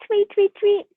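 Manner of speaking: high chirpy bird calls; bird chirping. Acted. Birds bringing the dress.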